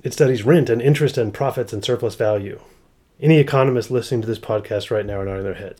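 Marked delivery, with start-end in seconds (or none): none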